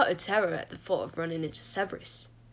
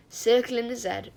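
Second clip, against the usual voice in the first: ordinary delivery, nothing marked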